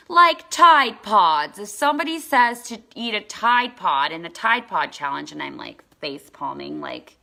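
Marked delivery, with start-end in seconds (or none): 0.1-5.3 loud, higher-pitched imitation of a child; kid chiming in. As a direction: blurt it out like an excited kid. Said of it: "this other kid that comes every day".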